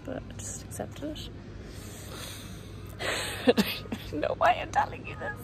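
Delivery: soft embarrassed whisper with a giggle. Real, not acted. Confessing a messy burger to viewers, in a whisper. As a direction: whisper it like a secret you regret sharing.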